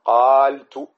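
قالت said very slowly with long alif, final ت sounded; teacher modelling the clashing form slowly; demonstrated form. Shown as a demonstration. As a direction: draw out قالت deliberately. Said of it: "فماذا تقول؟ تقول".

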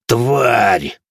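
loud furious curse; cursing. A narrator's reading. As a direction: spit out the curse. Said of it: "выругался маркиз".

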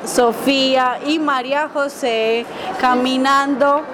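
plain reading voice

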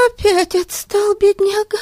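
put-on high girl voice, out of breath; breathless girl. A narrator's reading. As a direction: gasp it out as a young girl. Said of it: "задыхаясь".

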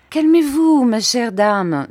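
high, sweet, slow soothing plea; softest voice. Acted. Doctor soothing the furious aunt, high sweet voice. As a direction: coax her gently, high and sweet. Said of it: "de sa plus douce voix".

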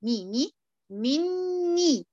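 "مي، مي، مني" very slow, noon held long through the nose; slow drawn ghunna. Shown as a demonstration. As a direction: drag out "مني" with a long nasal hum. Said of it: "تاني".

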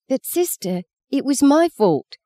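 put-on little girl wails to the nun, very high; wailing plea. A narrator's reading. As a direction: wail it like a desperate little girl. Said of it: "Maggie wailed".

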